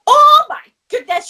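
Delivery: a high, loud "oh my goodness" as the friend; 1 retold excitement. Woman telling a friend about the lollipop lady, exclaiming loud and high.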